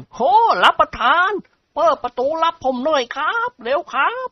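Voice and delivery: high, urgent servant's cry for the door; Haew shouting. A narrator's reading. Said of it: "ร้องตะโกนลั่น". (แห้วร้องตะโกนขอให้เปิดประตู)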